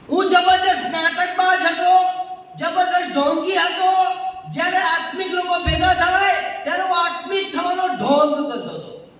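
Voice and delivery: loud, driving pulpit delivery; preaching at full force. Acted. Preacher to congregation, forceful preaching. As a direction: hammer each line home to the church.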